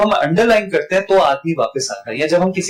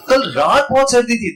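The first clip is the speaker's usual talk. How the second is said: रात raised high and held; stress on रात; 7 semitones higher than usual. Shown as a demonstration. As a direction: contrast रात with the daytime. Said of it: "दिन में तो गर्मी थी". (रात पर ऊँचे सुर में ज़ोर)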